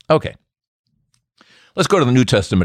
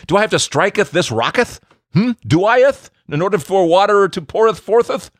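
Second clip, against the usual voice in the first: hammed-up King James English, higher; mock old English; 8 semitones higher than usual. Moses asks in mock old English, higher and hammed-up. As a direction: ham it up like old scripture. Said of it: "I think back then they said".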